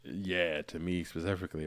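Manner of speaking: ordinary talk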